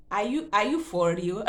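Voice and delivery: loud incredulous laugh; disbelieving laugh at the news; laughing disbelief. Real, not acted. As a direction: laugh it out, can't believe it. Disbelief at doctors' plan.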